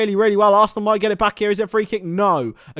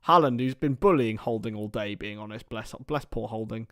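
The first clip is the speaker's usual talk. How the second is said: ordinary talk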